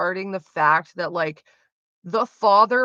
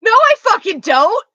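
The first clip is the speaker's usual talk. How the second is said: much higher, much louder explosive shout; furious yell; 12 semitones higher, 10 dB louder than usual. Retold or imitated. Daughter in the back seat yells at her dad, furious. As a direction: scream it back at him from the back seat. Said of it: "sitting there in the back seat like".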